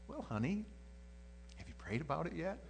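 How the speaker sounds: high, sing-song question with rising end; teasing sweetness. Retold or imitated. Mimics wife's needling question.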